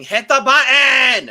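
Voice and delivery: stretched, laughing yell of the catchphrase; loud laughing shout. Retold or imitated. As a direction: shout it gleefully, drawn out slow. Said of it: "I go around my house on a daily basis saying".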